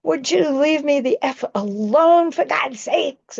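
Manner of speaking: high, loud mock yell at a stranger; imitating a furious shopper's yell; shrill retold screaming fit; angry scream. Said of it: "he screamed at me".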